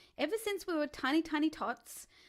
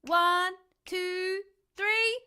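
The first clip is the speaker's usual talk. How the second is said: louder count, rising; loud countdown; 5 semitones higher, 6 dB louder, much slower than usual. Real, not acted. Coach counting viewers down to shout, loudly. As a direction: count them in with energy. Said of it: "I'm gonna count you down".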